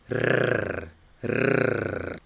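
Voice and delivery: long rolled tongue-tip R, twice; trilled Spanish R. Shown as a demonstration. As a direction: sustain a vibrating tongue trill, then repeat it. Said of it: "utilizamos la R vibrando la lengua".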